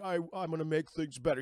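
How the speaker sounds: lightly imitated film-villain voice; a hint of a creepy movie killer; Buffalo Bill. Man on a phone call, Buffalo Bill voice. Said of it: "He sounds like Buffalo Bill".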